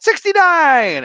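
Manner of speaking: put-on high, loud, slow child's yell; imitating a toddler scream. Mother imitates her daughter screaming an answer. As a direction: scream it like a three-year-old. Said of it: "my daughter screamed".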